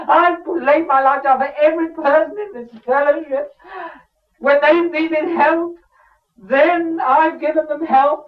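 high, loud self-pitying wail; whining Elijah. Acted. Preacher voicing Elijah's self-pitying wail, high and loud. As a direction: moan like a martyr nobody helps.

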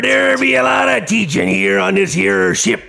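loud, hammed-up pirate growl. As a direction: bellow like a pirate captain. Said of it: "the pirate teacher".

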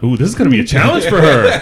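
high, loud, laughing excitement; gleeful at the challenge; excited. Real, not acted. Excited player sizing up boxer.

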